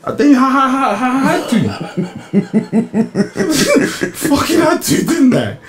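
high, laughing through the words. Real, not acted. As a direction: giggle out the confession. Laughing at own confession.

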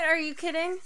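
normal talking voice

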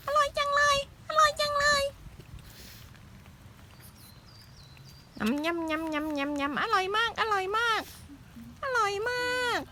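put-on high squeaky baby voice; cute baby voice. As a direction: chirp it like a tiny critter. Voicing the baby eating wood.

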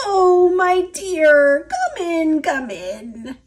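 wolf faking a frail grandma; disguised grandma. A narrator's reading.

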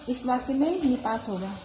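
ordinary talk

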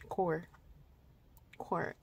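soft Chicago-accented "car", like "core"; real Chicago accent. Shown as a demonstration. Vlogger repeating how she said car, soft Chicago accent. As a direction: quietly echo your own Chicago vowel. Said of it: "I just said the real Chicago".

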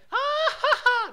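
loud, high mock laugh from the radio. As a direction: burst out in a shrill hammed-up cackle. Station blaring a laugh.

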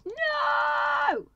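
very high, very loud cry of despair; anguished shout. Real, not acted. Player mourning his parrot, anguished shout. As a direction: scream it out in despair.

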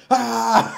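high, loud mock outburst; hammed-up angry cry; mock angry shriek. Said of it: "she was like".

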